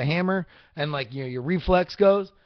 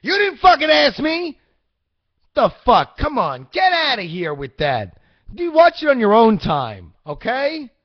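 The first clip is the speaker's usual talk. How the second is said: high, loud, half-joking snap; playfully heated, pitched up and loud; mock defensive; 7 semitones higher, 6 dB louder than usual. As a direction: get defensive at the kid for laughs. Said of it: "I'm getting very defensive right now".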